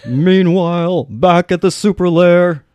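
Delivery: big dramatic cartoon narrator voice; Super Friends narrator. Shown as a demonstration. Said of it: "say it like you're on the Super Friends cartoon".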